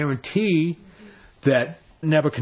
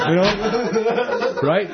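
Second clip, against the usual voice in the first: laughing; 6 semitones higher, much slower than usual. Real, not acted. Laugh after Jonah quote.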